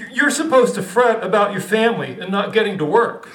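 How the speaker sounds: a hint of a bossy author; lightly voiced stern writer, half-laughing. Kafka lecturing Gregor.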